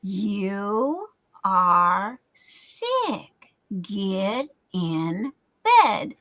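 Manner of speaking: very slow, each word spelled out; plain simple English. Shown as a demonstration. Vlogger showing her husband how he should have said it, very slow and plain. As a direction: talk it down like first grade English. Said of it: "break that crap down in first grade English".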